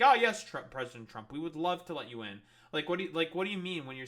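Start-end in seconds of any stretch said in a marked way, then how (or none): 0.0-2.4 mock fawning welcome. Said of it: "be like".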